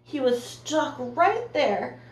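ordinary delivery

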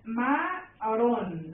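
CA... MA... RÓN, slow and separated; "Camarón" in three drawn-out beats; split into syllables. Shown as a demonstration. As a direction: say Camarón one syllable at a time. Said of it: "Lo separo".

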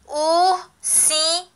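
"Ursinho" slow, in separate drawn-out syllables; in pieces. Shown as a demonstration. Teacher makes sure pupils understand, saying it syllable by syllable. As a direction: break "Ursinho" into clear pieces with pauses. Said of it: "falar a palavra em pedaço".

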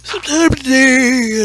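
real yawn stretching the words, high and loud at first. Real, not acted. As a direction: talk right through a big yawn. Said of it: "and I'm yawning".

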